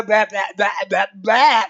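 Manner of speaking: loud, frustrated run of blahs; tongue-tied babble. Real, not acted. Streamer fumbling "no jukebox" to viewers, tongue-tied babbling. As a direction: shake out uncooperative lips with loud blahs. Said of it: "ability to control my lips has not improved".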